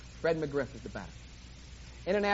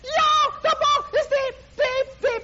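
excited announcer, much higher and louder, building; live play-by-play; 6 dB louder than usual. Acted. Preacher calling Ray's home run for the congregation, rising excitement. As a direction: call the homer like a radio announcer.

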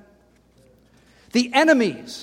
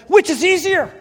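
Jesus challenging; 6 semitones higher, 4 dB louder than usual. Acted.

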